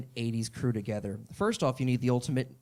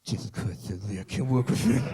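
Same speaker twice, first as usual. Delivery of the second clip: defeated Stallone voice; 7 semitones lower than usual. Retold or imitated.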